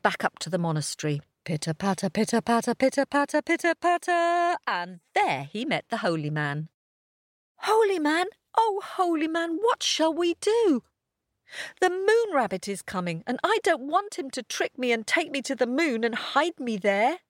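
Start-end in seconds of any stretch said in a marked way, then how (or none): 1.4-4.6 each one higher; rising sing-song. A narrator's reading. Rising footsteps sound. 7.7-17.2 high, eager puppy voice; little dog voice. A narrator's reading. Pip voiced.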